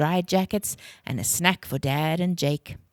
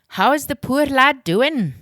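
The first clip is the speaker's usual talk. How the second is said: Uncle Alistair; 9 semitones higher, 6 dB louder than usual. A narrator's reading. Uncle Alistair's voice, a story figure.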